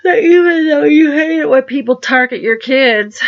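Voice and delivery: words stretched through a real yawn; yawning while talking. Real, not acted. Yawning while scolding Donald about targeting kids. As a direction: talk right through the yawn, pitch pushed up. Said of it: "Sorry, I was yawning while talking".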